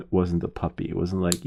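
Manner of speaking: normal talking voice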